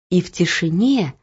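ordinary delivery

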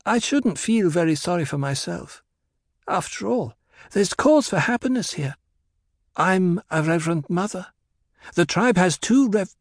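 high-pitched character voice, breaking off at the end; Alia consoling Hera. A narrator's reading. Alia telling Hera not to be sad, high voice.

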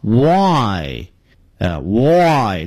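"Y" drawn out as w gliding into a long ai; slow, stretched "Y"; Y pronunciation demo. Shown as a demonstration. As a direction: round the lips, then open into a long "Y". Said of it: "那么就念成".

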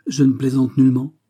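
insist, carried away by passion; exalted cry. A narrator's reading. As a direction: burst out that she is not joking. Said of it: "s'écria la jeune femme exaltée".

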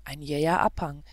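plain reading voice

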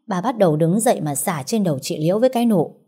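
ordinary delivery, nothing marked

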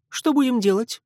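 put-on high, brisk girl voice; businesslike girl. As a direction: ask it matter-of-factly. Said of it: "деловито спросила Фи".

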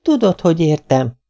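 lightly voiced indignant cry; exclaimed. A narrator's reading. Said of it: "kiáltott fel a lány".

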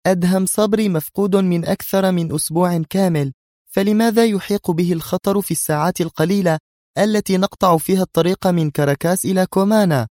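high, insistent, heated argument; sharp retort. A narrator's reading. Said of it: "أجابتها نادية في حدة". (بحدة)

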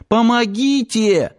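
high, drawn-out frightened cry; cry for help. A narrator's reading. Girl under attack calling for help, frightened.

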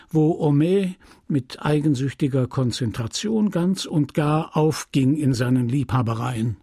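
usual speaking voice

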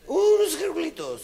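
put-on very high loud drunken voice; high squeaky drunken laugh; drunk squeak. Drunk orders snails.

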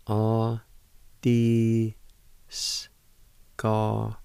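"Ah, dee, s" sounded out in separate pieces; syllable by syllable. Shown as a demonstration. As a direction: break the word into "Ah, dee, s" slowly. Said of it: "It'll sound something like this".

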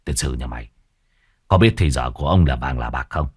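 put-on low, biting scold from the old chief; furious old chief. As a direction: cut the servant short in anger. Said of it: "Lý An đâm ra điên tiết". (lão Lý gắt lên, giọng trầm)

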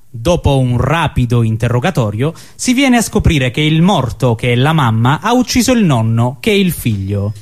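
ordinary talk, nothing marked

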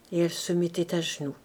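usual speaking voice

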